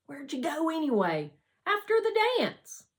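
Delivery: high, loud, curious; eager question. Acted. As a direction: press him eagerly for the answer. Harlan asking eagerly.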